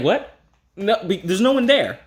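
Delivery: high, startled disbelief. Retold or imitated. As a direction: jump at an unseen voice.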